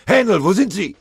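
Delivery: high, loud searching shout; roaring call. A narrator's reading. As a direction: bellow for a man out of sight. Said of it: "brüllte Feldwebel Matischek".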